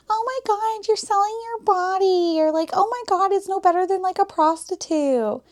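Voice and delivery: high, mocking judgmental voice; sneering high imitation of critics; mocking judgers. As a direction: parody the shocked commenters. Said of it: "there's all the comments of".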